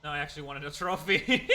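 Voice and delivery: laughing through a joke. Real, not acted. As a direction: say it while cracking up. Joking reply to game character.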